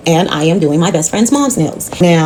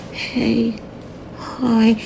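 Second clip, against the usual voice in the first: mocking a soft, high, sugary hello; fake sweet greeting; 5 semitones higher, 8 dB quieter than usual. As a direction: play the sick client laying on the sweetness. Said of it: "this how she doing it".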